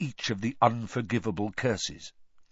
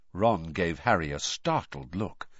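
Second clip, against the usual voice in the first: usual speaking voice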